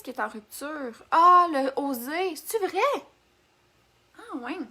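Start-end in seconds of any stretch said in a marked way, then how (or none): 1.1-4.6 drawn-out "ah", then rising high; surprised disbelief. Real, not acted. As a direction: react to unexpected news, pitch jumping up. Learns favourite product is out of stock. (surprise incrédule)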